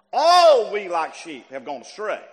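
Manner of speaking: "All" very loud and stretched, then dropping away; long, booming "All" at the start; stress on All. Shown as a demonstration. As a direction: blast the first "All" and let the rest fall. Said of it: "begins with the word all".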